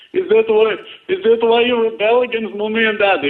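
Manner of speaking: ordinary delivery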